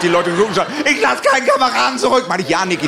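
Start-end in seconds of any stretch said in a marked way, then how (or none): 0.9-2.2 war movie mode. Retold or imitated. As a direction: proclaim it like an action movie hero. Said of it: "nicht so laut".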